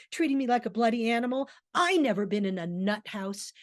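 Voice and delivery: rapid, loud, indignant rant; Davies's cruel tirade. Acted. Davies turns on Aston with cruel insults, angry rant. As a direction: spit out the insults in a fast tirade. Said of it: "says a really awful thing".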